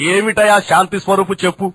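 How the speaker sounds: ordinary talk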